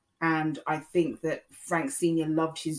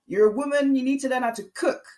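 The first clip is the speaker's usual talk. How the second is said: high, louder, fast mock-scolding mother; imitating her mum; 8 semitones higher, 5 dB louder than usual. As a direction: rattle it off like a nagging mum. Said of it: "she said to me".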